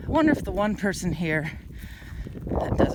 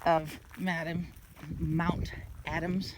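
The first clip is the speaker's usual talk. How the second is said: heavy breathing; 6 dB quieter, slower than usual. Real, not acted. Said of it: "Excuse the heavy breathing".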